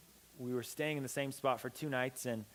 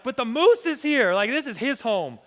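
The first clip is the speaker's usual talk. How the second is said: scared protest; 9 semitones higher, 11 dB louder than usual. Retold or imitated. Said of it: "And I was terrified".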